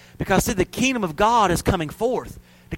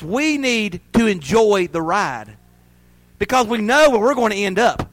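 ordinary talk

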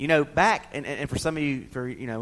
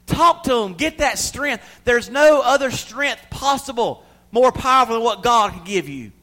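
loud, high preaching push; full-force preaching; 10 semitones higher, 10 dB louder than usual. Acted. Preacher urging the grieving congregation, loud and high. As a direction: preach it out to the crowd.

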